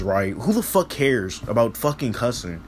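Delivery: ordinary talk, nothing marked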